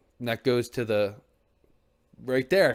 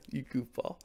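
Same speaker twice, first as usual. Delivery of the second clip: laughing tease; 4 semitones higher, 4 dB quieter than usual. Real, not acted. Laughing at a chatter.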